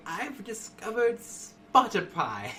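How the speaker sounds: put-on high, loud, very slow old lady; proud old granny voice, stretched right out; old woman. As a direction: draw out "Spotify" as "spot-a-pie". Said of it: "this old woman just knitting".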